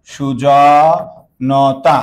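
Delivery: সুজনতা stretched very slowly, syllable by syllable; drawn out slowly. Shown as a demonstration. Teacher stretching সুজনতা so students catch each sound, very slow. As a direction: spell out সুজনতা slowly for the class. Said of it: "Sujan যোগ তা".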